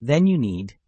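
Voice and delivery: upbeat retro commercial read; chipper 1950s ad. Acted. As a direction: sell it like an old TV ad. Ad build-up.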